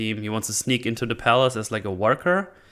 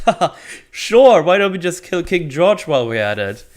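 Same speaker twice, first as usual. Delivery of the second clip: scoffing laugh, higher and louder; mock laughing reply; 7 semitones higher, 6 dB louder than usual. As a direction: laugh it off sarcastically. Crowd laughing off the idea.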